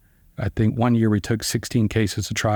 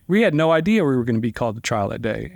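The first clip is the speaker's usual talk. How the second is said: ordinary talk, nothing marked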